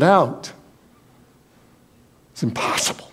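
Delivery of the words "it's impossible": hushed breathy whisper; whispered aside. Acted. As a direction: let it slip under your breath. Aside about the withered arm.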